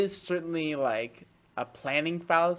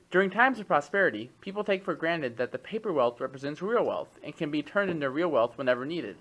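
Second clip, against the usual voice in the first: measured expository read-aloud, crisp phrase by phrase; 2 semitones higher than usual. Acted. Podcast host reads an economics text to co-host, reading aloud. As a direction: read it out like a written treatise.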